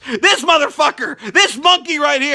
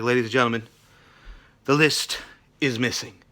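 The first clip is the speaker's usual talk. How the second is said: hushed, grave announcement, softer; mock somber; 12 semitones lower, 8 dB quieter than usual. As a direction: announce it like terrible news. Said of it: "in a somber mood".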